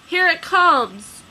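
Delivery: loud, slow cry of alarm; excited shout. Acted. Bystander in the crowd shouts in alarm. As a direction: shout it out as the shadow falls. Crowd cries out at approaching robot.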